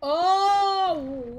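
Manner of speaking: high loud surprised oh. Real, not acted. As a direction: burst out in surprise. Surprised discovery.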